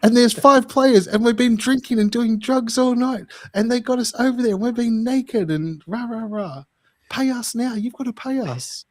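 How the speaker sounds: high mocking woman's voice demanding payment; mock shrieking women. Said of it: "And these women say".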